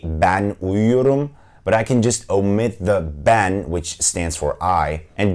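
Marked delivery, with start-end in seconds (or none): 0.0-1.3 slow, careful Turkish "Ben uyuyorum"; Turkish phrase said slowly. Shown as a demonstration. Teacher demoing Turkish to students, slow and careful. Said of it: "I can say".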